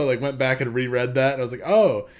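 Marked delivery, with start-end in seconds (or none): 1.7-2.1 high loud oh of realization; a sudden dawning gasp; surprised oh. Retold or imitated. Sudden realization while rereading.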